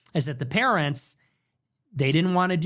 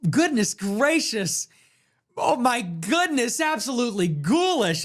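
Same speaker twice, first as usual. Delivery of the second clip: mock pearl-clutching; 7 semitones higher than usual. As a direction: imitate a prissy poster who is appalled. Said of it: "they're always like".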